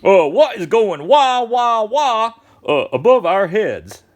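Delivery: high, loud, stretched-out astonished question; big, slow picture-book exclamation; dramatic storybook reading. Acted. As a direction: draw out each noise word for young listeners. Reading storybook characters puzzled by noise.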